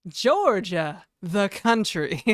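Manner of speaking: loud, slow, stressing the country; quoting a Community character, loud and drawn out; emphatic TV quote. Retold or imitated. Streamer quoting a Community character to chat, loud and emphatic. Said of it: "what's his name is like".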